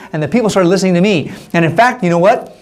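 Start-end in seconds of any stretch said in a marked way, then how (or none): none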